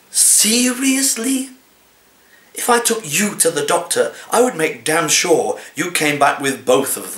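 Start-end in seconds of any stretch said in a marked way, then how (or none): 0.1-1.5 slow rising disbelief. Acted. Cat poem, repeated disbelief.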